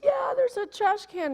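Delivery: put-on small child's voice. Child answering through door.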